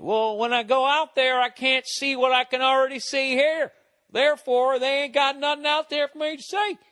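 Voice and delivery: hammed-up Southern yokel voice; a Southern local scoffing at the empty desert, in a Southern accent. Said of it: "I shouldn't have done that in a Southern accent".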